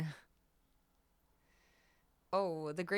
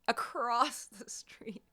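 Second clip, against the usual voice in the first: unvoiced whispered repeat; 4 semitones higher, much slower than usual. Shown as a demonstration. Streamer repeating to chat, whispered. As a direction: whisper it in disbelief. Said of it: "repeats in whisper".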